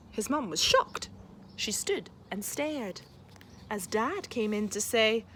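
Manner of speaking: lively, expressive picture-book read-aloud; reading to children. A narrator's reading. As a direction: read it like a bedtime story for a class.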